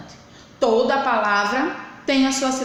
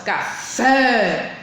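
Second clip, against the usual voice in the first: "café" with FÉ punched out and held; forceful stressed syllable. Shown as a demonstration. Teacher showing pupils the strong syllable, forceful long FÉ in "café". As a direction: push hard on the final FÉ of "café". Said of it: "eu posso dizer que ela é com mais força".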